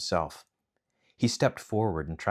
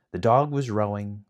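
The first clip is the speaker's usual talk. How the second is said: ordinary delivery, nothing marked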